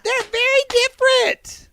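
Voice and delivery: very high, slow squawk of exasperation; exasperated yell. Real, not acted. Scolding Siri for the mix-up, high-pitched exasperation. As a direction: scold in a high, drawn-out screech.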